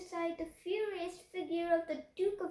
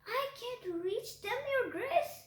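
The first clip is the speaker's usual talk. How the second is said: higher voice calling down from a ladder; calling back; 7 semitones higher than usual. A narrator's reading. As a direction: call down apologetically. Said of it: "the gardener called back".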